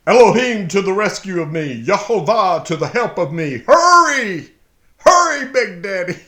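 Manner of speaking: loud, high, urgent plea, rushing the words; desperate cry for help. Acted. Preacher voicing the psalmist's plea, loud and pressing. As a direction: cry out to God like someone drowning.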